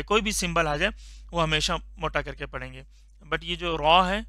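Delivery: ordinary delivery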